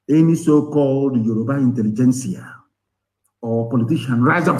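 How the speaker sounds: ordinary talk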